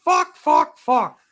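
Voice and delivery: high, loud mock shouting of fuck; hammed-up furious marshal yelling; imitating a marshal's high shouted curses; angry yelling. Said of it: "he goes".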